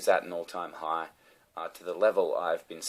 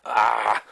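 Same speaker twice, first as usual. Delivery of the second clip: loud dog-like growl; frustrated growl; 4 semitones higher, 12 dB louder than usual. Real, not acted. Man fed up with his new TV, frustrated growl. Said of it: "Look at me growling like a dog".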